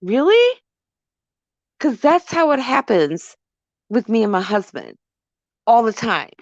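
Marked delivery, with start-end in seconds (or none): 0.0-0.6 high rising really; incredulous really. Real, not acted. Storyteller scoffing at the catcaller, high incredulous rise. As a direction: scoff in disbelief.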